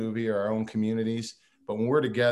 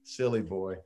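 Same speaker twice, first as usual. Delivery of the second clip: soft, lower, indulgent tut-tut; softer and lower, mock pity for the tall friend; patronizing; 3 semitones lower, 5 dB quieter, slower than usual. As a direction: talk down to him like a fond aunt. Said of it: "I'm like".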